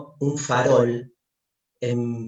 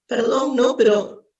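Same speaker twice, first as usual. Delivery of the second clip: anguished madman; 7 semitones higher than usual. Acted. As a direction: ask it as if the ground is gone. Madman's rising questions.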